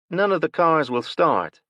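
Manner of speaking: high, loud woman stating None of the cars will start with a falling end; woman's flat statement. A narrator's reading. Woman parked nearby tells Will, statement falling. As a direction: say it as a fact, the tune dropping on start. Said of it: "the woman parked next to them said".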